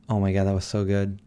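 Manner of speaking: ordinary talk, nothing marked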